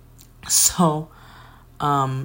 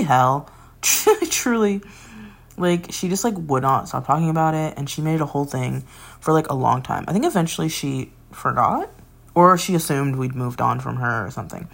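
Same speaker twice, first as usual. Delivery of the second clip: normal talking voice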